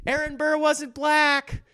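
high, slow mock whine; high slow mock heckler correction; mock pedantic heckler. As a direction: whine it like a fussy know-it-all.

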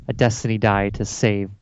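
usual speaking voice

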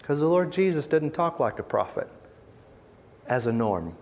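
ordinary delivery

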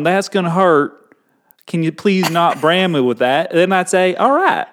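folksy Southern rancher voice; Southern accent. Pretend. Explaining the rule through cattle branding, Southern accent. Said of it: "do it in a Southern accent".

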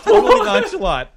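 high, loud words through laughter; real laughing. Real, not acted. Son marvelling at mom's casual blunder, real laughing. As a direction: barely get it out while cracking up. Cracking up at own story.

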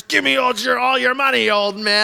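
high-pitched mock shakedown; bullying guard. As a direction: demand it like a cartoon thug, half laughing. Imitated guards robbing the elderly.

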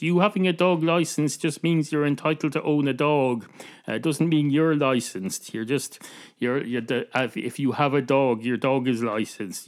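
ordinary delivery

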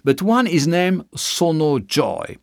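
usual speaking voice

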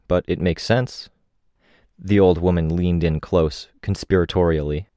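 ordinary delivery, nothing marked

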